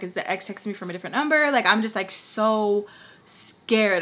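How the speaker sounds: ordinary talk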